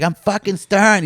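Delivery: grandma snapping back. Retold or imitated. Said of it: "she's like".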